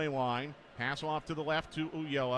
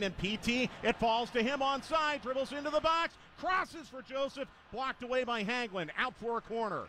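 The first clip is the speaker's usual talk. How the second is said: excited play-by-play; 8 semitones higher than usual. Real, not acted. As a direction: build the call up with the attack. Attack into the box.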